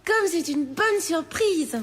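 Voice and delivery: slow, lilting French welcome. Acted. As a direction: savour the pleasant surprise in French. French governess greets a visitor.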